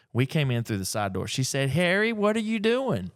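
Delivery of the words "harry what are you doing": high-pitched mock yell from below; wife yelling up the stairs. Wife calling up to husband from downstairs, high mock yell. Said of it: "his wife yelled up from the stairs".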